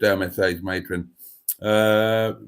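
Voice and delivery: usual speaking voice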